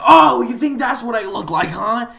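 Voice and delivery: high, loud mock outrage; mock offended player. Storyteller imitating the offended guy's retort, mock outrage. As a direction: exaggerate an affronted comeback.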